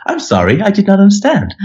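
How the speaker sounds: high-pitched automated refusal, mocking the phone system; automated phone line. Caller imitates the bank's phone robot before it cuts them off, automated voice. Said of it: "before they go".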